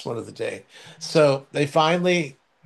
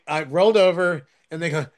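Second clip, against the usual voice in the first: normal talking voice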